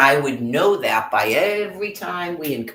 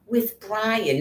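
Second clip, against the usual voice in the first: mock nosy voice; 9 semitones higher than usual. Mimicking nosy question.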